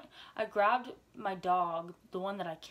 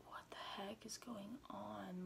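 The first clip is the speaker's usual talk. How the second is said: hushed; 14 dB quieter, slower than usual. Retold or imitated. Dad voiced on phone.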